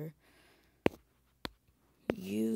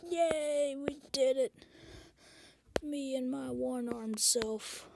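excited cheer; 9 semitones higher, 3 dB louder, slower than usual. Acted. Cheering after shooting all zombies.

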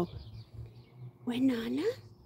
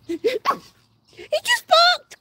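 high, loud, slow delighted squeal; excited squeal; 8 dB louder, much slower than usual. Real, not acted. Owner thrilled the dog just barked, slow high squeal.

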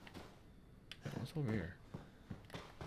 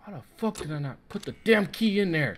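angry rant; 4 semitones higher, 6 dB louder than usual. Real, not acted. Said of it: "Oh, I'm pissed off".

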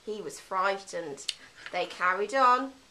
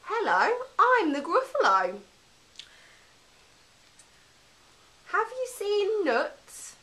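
big monster greeting, pitched way up; Gruffalo voice; 10 semitones higher than usual. Acted. Gruffalo greeting the mouse, high put-on monster voice. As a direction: play the Gruffalo asking for nuts, high and sing-song.

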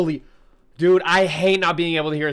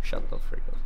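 hissed whisper; fierce whispered complaint at the game noise. Real, not acted. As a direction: whisper it sharply through the teeth. Hissing at the game.

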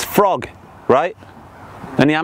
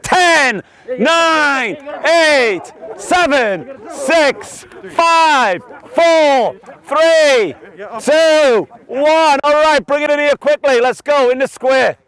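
high, projected coach's call; shouted countdown; 6 semitones higher, much slower than usual. Real, not acted. Coach counts down and gathers players in the square, high projected call. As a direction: call it out across the group.

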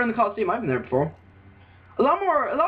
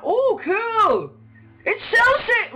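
excited; 3 dB louder, much slower than usual. Real, not acted. Gamer thrilled at the game's art, excited. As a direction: pitch jumps way up, delighted. First sight of the game graphics.

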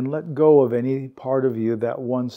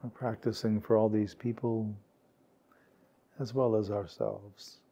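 calm, unhurried meditation guide, words spaced by long pauses; guided meditation; 2 semitones lower, 5 dB quieter than usual. Real, not acted. Meditation teacher guiding warm-hearted peers to relax, calm and unhurried. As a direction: gently lead a sitting group into stillness, leaving space.

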